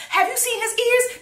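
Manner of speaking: high, incredulous squeal; mock disgusted squeal. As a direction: squeal it out in outrage. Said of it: "going down the list of why she hates".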